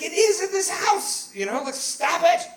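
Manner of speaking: high-pitched exasperated comeback; indignant scolding. Acted. Son-in-law objecting to smoking in this house, indignant. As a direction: scold her with your pitch shot up.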